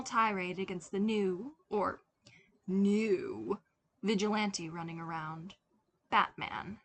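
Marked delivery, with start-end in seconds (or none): none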